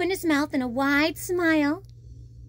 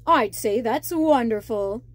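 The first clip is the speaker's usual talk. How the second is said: king's reply; 3 semitones lower than usual. Acted. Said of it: "King Triton replied".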